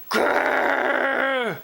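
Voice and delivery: mock growl of rage; angry growl. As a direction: growl at the fish. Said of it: "said Cheryl's father".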